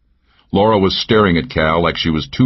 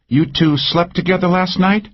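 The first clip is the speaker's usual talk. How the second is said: higher, teasing woman's voice; Cal's voice; 13 semitones higher than usual. A narrator's reading. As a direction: needle him with a pitched-up lilt. Narrator voices Cal.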